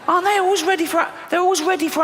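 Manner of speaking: high fast twitchy squeak; jittery spider voice. Pretend. Comedian voicing a spider, high and fast, twitchy. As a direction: play a hyper-alert spider.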